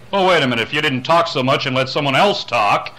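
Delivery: cutting in. Retold or imitated. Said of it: "he starts taking and cutting".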